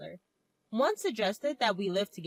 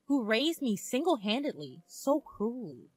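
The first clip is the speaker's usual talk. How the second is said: ordinary delivery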